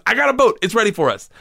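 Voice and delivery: triumphant reassurance; excited friend. Retold or imitated. Friend insisting to Igor, high and excited.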